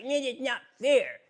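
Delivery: put-on whiny child. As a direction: whine like a toddler denied his toy. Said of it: "is like a child".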